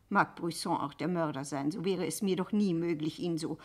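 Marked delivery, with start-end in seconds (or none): none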